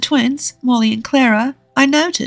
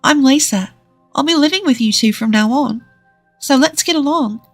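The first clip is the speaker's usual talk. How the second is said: put-on high, gentle woman's voice for children; 6 semitones higher than usual. A narrator's reading. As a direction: reassure two little girls in a gentle, higher voice. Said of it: "Lisa spoke to Molly and Clara in a gentle voice".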